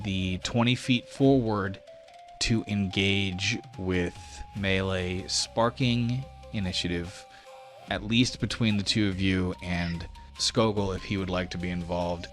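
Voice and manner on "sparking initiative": usual speaking voice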